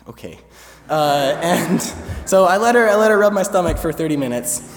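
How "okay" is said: very soft, lower reluctant okay; resigned to the healer's claim. Retold or imitated. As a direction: give in quietly. Resigned acceptance.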